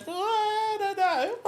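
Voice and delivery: very high, slow hesitant voice for her; doubtful wife. Retold or imitated. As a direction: drag out the doubt knowingly. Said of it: "She goes".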